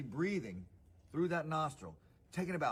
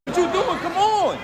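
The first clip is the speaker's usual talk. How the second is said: very loud, high-pitched yell; urgent shouting; 17 dB louder than usual. Real, not acted. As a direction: yell at them to get moving. Calling out amid evacuation.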